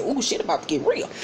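soft, anticipatory undertone; hushed aside. Retold or imitated. Viewer watching a fight brew, hushed aside. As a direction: drop the volume as if watching trouble brew.

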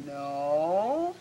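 drawn-out slow "No."; slow demo. Shown as a demonstration. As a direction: stretch "No." out slowly, letting the letters join. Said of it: "put them close together very slowly".

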